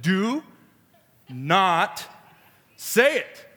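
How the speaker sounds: each word punched separately, pauses between; emphatic warning. Retold or imitated. Repeats warning with force.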